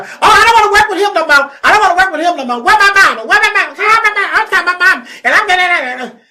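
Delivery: put-on high, loud, fast whining like a spoiled teen; whiny upset teen. Mocking the upset daughter crying for her mama, high loud whine. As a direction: wail it high like a brat wanting mama. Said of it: "Baby, she got upset".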